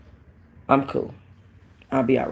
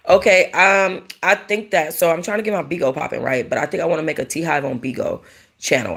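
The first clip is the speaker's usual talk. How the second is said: ordinary talk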